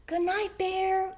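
high, airy little mouse call; soft call. A narrator's reading. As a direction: call gently in a tiny mouse voice. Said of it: "Mouse called softly".

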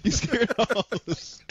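high-pitched, helpless laughter in the words; real laughing delivery. Real, not acted. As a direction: try to say it while cracking up. Cracking up at own joke.

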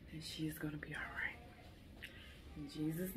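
fast, soft whisper of hope; whispered prayer. Real, not acted. Mother praying for her baby in surgery, whispered. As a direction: breathe it out like a private prayer.

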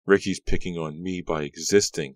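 usual speaking voice

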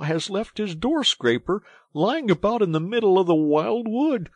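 loud, high, huffy tirade; indignant grumbling. A narrator's reading. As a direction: threaten to lodge a complaint. Mole grumbles about careless householder.

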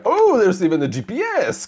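a high, loud, delighted gasp of discovery; excited surprise. Real, not acted. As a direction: burst out on finding an unexpected gift. Finds GPS in the package.

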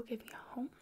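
ordinary talk, nothing marked

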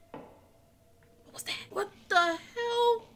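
slow hushed whisper. Real, not acted. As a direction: breathe it so nobody hears. Sneaking past monsters.

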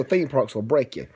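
ordinary delivery

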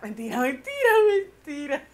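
high, loud laughing "mentira"; cracking up while taking it back. Real, not acted. To viewers, admitting her fib while laughing.

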